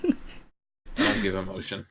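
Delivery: deadpan robotic delivery; emotionless monotone. Shown as a demonstration. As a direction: say it with no emotion at all. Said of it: "talking like this with no emotion whatsoever".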